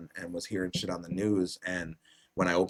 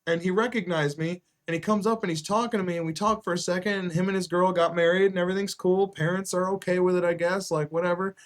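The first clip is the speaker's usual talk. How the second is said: excited retelling; 10 semitones higher, 6 dB louder than usual. Retold or imitated. Friend's excited retold story.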